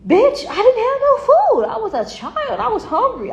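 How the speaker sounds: high, slow, laughing protest; high incredulous laugh through the words; laughing retort. Real, not acted. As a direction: laugh it off in disbelief. Laughing retort to old creditor.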